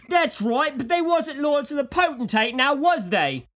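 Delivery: loud, high hammed-up Cockney accent; mock Cockney. Co-host joins the Cockney guard bit, hammed-up Cockney. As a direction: join in with a silly Cockney bloke. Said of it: "Cockney man".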